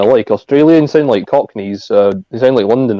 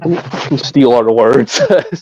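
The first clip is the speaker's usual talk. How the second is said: mock indignant; 2 semitones higher than usual. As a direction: spell it out like a scolding, half joking. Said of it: "I'm like".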